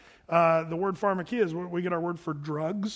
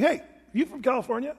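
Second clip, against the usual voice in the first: clear as a bell; 6 semitones higher, faster than usual. Retold or imitated. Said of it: "just as clear as a bell saying".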